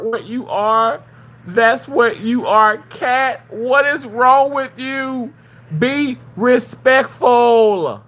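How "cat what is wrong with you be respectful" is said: scolding. Real, not acted. Scolding Cat.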